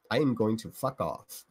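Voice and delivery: ordinary delivery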